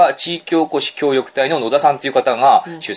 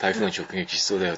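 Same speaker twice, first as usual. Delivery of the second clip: low, soft, quick throwaway; casual aside; 4 semitones lower, 9 dB quieter than usual. Retold or imitated. Host imitating a listener's casual typhoon aside, offhand. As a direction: slip it in offhand, under your speech. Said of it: "さりげなくね".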